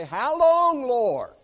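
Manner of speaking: loud, high drawn-out plea; psalmist's cry. Acted. Psalmist crying out to the Lord, pleading loudly. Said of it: "where the psalmist would say".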